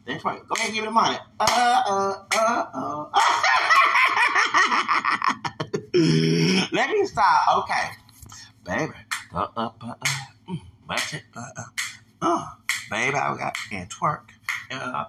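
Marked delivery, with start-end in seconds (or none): 3.1-6.7 high laugh. Real, not acted. Laughing at herself dancing. 8.6-11.6 whisper. Real, not acted. Whispered aside while dancing.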